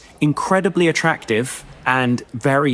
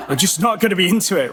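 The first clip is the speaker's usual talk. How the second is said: laughing; 7 semitones higher, faster than usual. Real, not acted. Laughs through the repeat.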